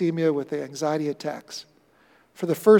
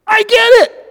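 high, loud burst of realisation; excited discovery; 14 dB louder than usual. Acted. Preacher voicing Paul, excited shout of discovery. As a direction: shout it as the penny drops.

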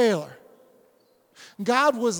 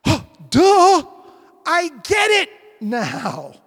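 gasp, then loud high exclamation; hammed-up eureka, loud and stretched; acted sudden realization; 7 semitones higher, 11 dB louder, much slower than usual. Disciples finally grasping scripture, loud amazed realization.

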